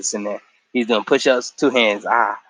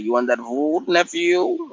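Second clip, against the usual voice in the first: a hint of an old uncle offering food; lightly voiced uncle, rising on nephew; light Iroh voice; 5 semitones higher, 3 dB quieter, slower than usual.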